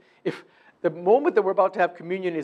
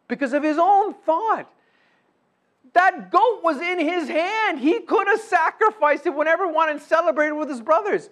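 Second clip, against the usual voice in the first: high, loud, impassioned preaching cry; full-force preaching; 12 semitones higher, 8 dB louder than usual. Real, not acted. Preacher lamenting the older son who missed out, impassioned shout. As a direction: drive it home at the top of your voice.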